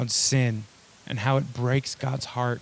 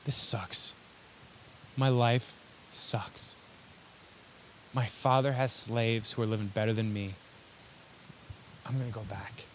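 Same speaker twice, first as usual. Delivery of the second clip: low, quiet voice of defeat; subdued misery, trailing quieter; dejected son; 3 semitones lower, 10 dB quieter than usual. Acted. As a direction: sink into soft, hopeless resignation. Prodigal son despairing among the pigs.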